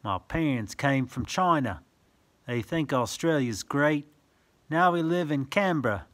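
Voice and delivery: sing-song rhyming read-aloud; reading verse to children. Acted. Reading a picture book to a child, sing-song. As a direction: lilt the rhyme for little listeners.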